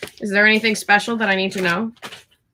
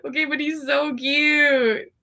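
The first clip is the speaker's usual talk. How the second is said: usual speaking voice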